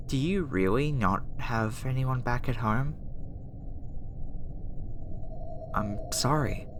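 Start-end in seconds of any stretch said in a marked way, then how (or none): 5.7-6.7 low, half-whispered apology. Acted. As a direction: offer gentle, hushed condolence. Sympathy for the lonely subject.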